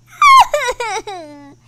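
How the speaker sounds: loud high laugh; burst of laughter. Real, not acted. Player bursts out laughing at her bad roll. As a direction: laugh out hard at the bad luck.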